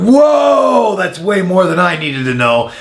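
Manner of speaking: high shouted whoa, easing as it goes on; retold shocked outburst. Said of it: "and I went".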